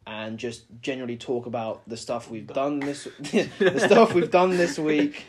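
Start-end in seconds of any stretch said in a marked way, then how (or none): 3.1-5.2 slow words through laughter; laughing. Real, not acted. Laughing while speaking.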